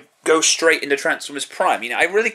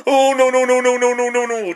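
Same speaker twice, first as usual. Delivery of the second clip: higher, louder mocking whine of no's; mock Shia LaBeouf; 9 semitones higher, 4 dB louder than usual. Reviewer mocking Shia LaBeouf as Sam, mock whiny no's. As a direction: ham up a whiny actor refusing. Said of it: "don't get fucking Shia".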